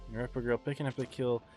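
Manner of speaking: ordinary talk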